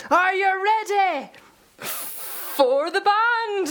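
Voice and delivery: high, slow, stretched Scottish crowd call; Scottish hype-man accent. Retold or imitated. Singer on stage rousing an emo crowd, in a stretched-out Scottish accent. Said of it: "saying things in, like, a Scottish accent through the microphone".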